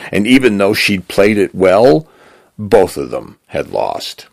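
ordinary delivery, nothing marked

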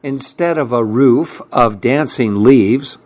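ordinary delivery, nothing marked

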